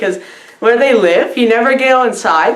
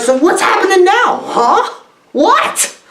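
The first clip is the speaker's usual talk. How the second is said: mock confusion; 10 semitones higher than usual.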